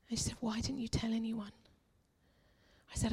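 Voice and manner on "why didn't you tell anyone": near-whispered gentle question; hushed concern. Retold or imitated. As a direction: softly voice a concerned friend. Retelling friend's gentle question.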